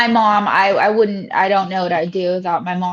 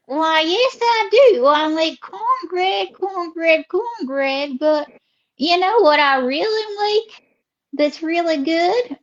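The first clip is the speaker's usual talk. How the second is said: swooping Southern drawl; Southern lady character; 7 semitones higher, slower than usual. Acted. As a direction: stretch the vowels like a chatty Southern belle. Host voices Ruby.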